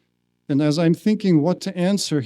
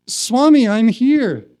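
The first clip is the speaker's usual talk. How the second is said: retold call, high and louder; insistent plea; 7 semitones higher, 5 dB louder, slower than usual. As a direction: plead to be noticed. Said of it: "More desperate".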